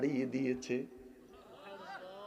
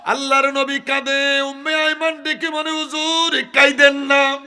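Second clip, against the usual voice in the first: high, loud, anguished sermon cry; impassioned preaching; 13 semitones higher, 16 dB louder than usual. Acted. Preacher voicing Umme Aiman begging him not to weep, anguished. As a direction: cry it out at full force.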